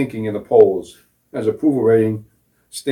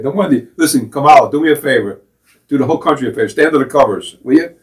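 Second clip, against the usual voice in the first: scolding rant; 4 semitones higher, 6 dB louder than usual. Real, not acted. Ranting directly at Kamala.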